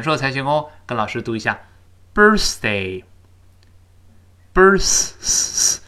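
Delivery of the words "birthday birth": "birthday, birth-" very slow, tongue tip just out on th; drilled th. Shown as a demonstration. Teacher breaks down birthday for students, very slow. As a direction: linger on the th in "birth-". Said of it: "舌尖吐出来一丁丁点儿".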